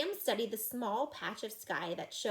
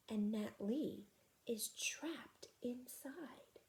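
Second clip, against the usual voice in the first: slow hushed whisper; 3 semitones higher, 8 dB quieter, much slower than usual. Acted. Boy whispers the bad news to friends, slow hush. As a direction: breathe it out, slow and secret. Hushed realization near cave.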